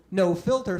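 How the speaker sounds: ordinary talk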